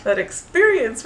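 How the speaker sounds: normal talking voice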